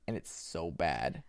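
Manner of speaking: usual speaking voice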